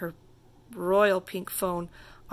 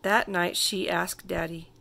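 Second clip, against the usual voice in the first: plain reading voice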